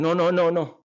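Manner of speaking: loud high rapid no's; frantic no. Retold or imitated. Woman stopping her partner, loud high panicked refusal. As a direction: panic and push him away. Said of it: "lifted up by his arms going".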